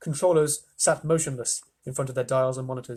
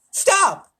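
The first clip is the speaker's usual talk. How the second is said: loud, very high one-word scream; frantic controller's shriek; 9 dB louder than usual. A narrator's reading. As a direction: scream to cut him off. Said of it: "screamed ground control".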